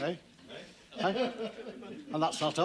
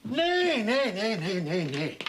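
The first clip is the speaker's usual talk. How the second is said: whinny like a lame horse; imitating a horse; slower than usual. Farmer to vet, mimicking his horse, mock neighing. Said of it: "does he sound like this?".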